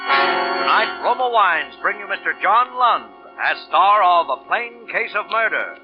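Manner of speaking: normal talking voice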